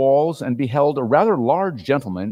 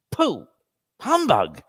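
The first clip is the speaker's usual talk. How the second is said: nervous; 4 semitones higher, slower than usual. A narrator's reading. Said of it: "was his nervous response".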